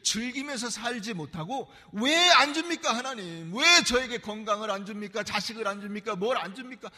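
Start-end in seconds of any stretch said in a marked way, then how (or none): 1.9-6.9 loud, higher demanding complaint to God; complaining to God. Retold or imitated. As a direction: throw the grievance upward. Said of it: "안 줍니까?"에 매여 있어요".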